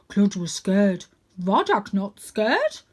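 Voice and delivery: slow, heavy simple-minded dog; big dog voice. A narrator's reading. Big dog apologising to the cat, slow and heavy. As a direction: lumber through it like a gentle giant.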